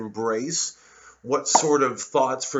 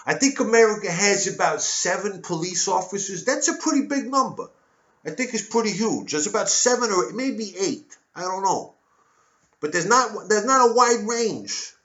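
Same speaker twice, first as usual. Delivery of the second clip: higher, louder mock-naive voice; clueless pundit; 8 semitones higher, 5 dB louder than usual. Pundit guessing the number of police, high loud mock-innocent voice. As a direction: naive commentator claiming America has seven police, higher and louder.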